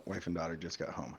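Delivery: ordinary talk, nothing marked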